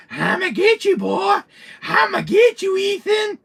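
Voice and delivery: very high, loud threat; hammed-up villain yelling his taunt. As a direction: holler it like a crazed pursuer.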